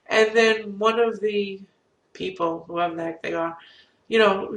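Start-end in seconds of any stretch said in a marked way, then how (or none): none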